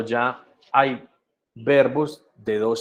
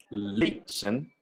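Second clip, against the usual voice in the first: LISTEN broken in two, LI stressed, SEN dropped; first-syllable stress; 3 semitones lower, 6 dB quieter than usual. Shown as a demonstration. Teacher illustrates the spelling rule, LISTEN stressed on LI. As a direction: lean on LI of LISTEN and let SEN fall away. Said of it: "la primera sílaba es la que tiene el acento".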